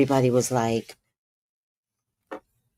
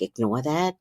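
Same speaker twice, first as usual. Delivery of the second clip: ordinary talk, nothing marked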